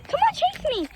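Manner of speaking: high playful call to the dog. Real, not acted. Owner urging her dog to chase her, high and playful. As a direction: lure the dog into chasing, up high.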